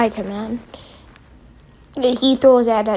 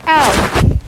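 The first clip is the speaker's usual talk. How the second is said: louder, sharp mock "Ow" of pain; 3 semitones higher, 7 dB louder than usual. Shocked Spider-Man yelps "Ow" after Electro's zap, loud. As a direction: yelp "Ow" like a cartoon hit. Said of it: "and he's like".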